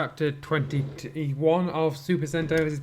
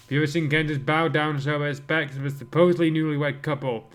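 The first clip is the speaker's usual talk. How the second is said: normal talking voice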